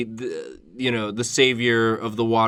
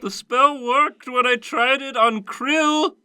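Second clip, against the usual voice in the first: high, slow baffled wizard voice; baffled young wizard; 10 semitones higher, slower than usual. Acted. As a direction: puzzle over the failed spell slowly.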